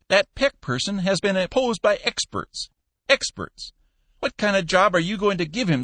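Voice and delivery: put-on scolding old Cappy. Old boss scolding his manager, high and loud. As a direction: rib him like a cranky old boss.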